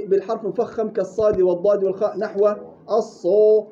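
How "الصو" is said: الصو said with lips rounded, the ا turned into a و; rounded lips error. Shown as a demonstration. Teacher demonstrating a wrong rounded vowel, louder. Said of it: "احنا قلنا لا".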